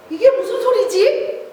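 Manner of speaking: just woken by a noise; surprised waking. Acted. Jesus woken by shouting.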